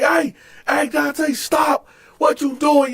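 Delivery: high-pitched guard calling out; urgent intervention. Retold or imitated. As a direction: imitate a guard breaking up a fight.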